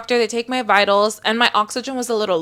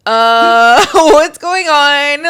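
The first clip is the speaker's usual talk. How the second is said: drawn-out, loud, higher wail of confusion; groggy confusion; 4 semitones higher, 9 dB louder, much slower than usual. Retold or imitated. Retelling waking up sick to friends, loud mock bewilderment. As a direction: moan it slowly, loud and bewildered.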